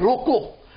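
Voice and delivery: रोको drawn out slowly, then a stop; pause after रोको. Shown as a demonstration. Politician shows host how one comma works, रोको set apart. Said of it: "उसको अपन बाद में लगाते हैं".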